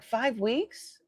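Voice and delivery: replayed puzzled echo; retold disbelief. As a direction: check it in mild surprise. Repeating her disbelieving question.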